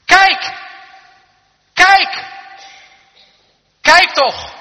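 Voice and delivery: high, full-force repeated cry; urgent shouting. Real, not acted. Preacher urging congregation to look as Moses did, shouting. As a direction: shout each appeal to the congregation. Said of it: "dat mag ik vanmiddag ook doen".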